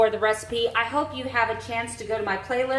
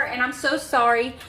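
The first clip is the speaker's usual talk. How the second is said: ordinary talk, nothing marked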